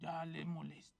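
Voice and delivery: soft, low thinking aloud; pensive musing. Acted. Lizard alone wondering about a friend, pensive soft musing.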